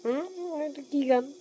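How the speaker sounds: high-pitched imitation of a teasing question; amused uncle. Uncle teasing the singer about the song, amused mock questioning. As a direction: quote the uncle's amused query, pitched well up. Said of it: "হাসতেছে যে".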